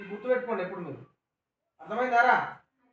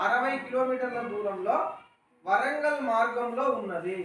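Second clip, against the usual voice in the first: slow and deliberate, word by word; slow model reading; slower than usual. Shown as a demonstration. Teacher to pupils, slow dictation-style reading. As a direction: give the pupils time to repeat. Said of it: "sir ఎలా పలుకుతున్నాడు".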